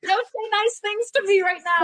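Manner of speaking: very high mock-weepy squeal; comic protest on the edge of tears; retold tearfulness. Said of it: "I started crying".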